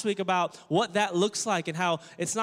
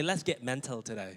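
low, soft attempt at a British accent; 7 semitones lower, 7 dB quieter than usual. Shown as a demonstration. Preacher cueing the congregation to copy him, British accent. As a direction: put on your best British accent. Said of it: "In your best British accent".

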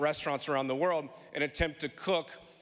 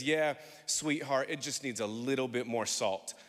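gentle grandmother; 6 semitones lower than usual. Retold or imitated. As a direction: comfort him while delivering the verdict. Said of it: "puts her hand on this world-renowned chef's shoulder and says".

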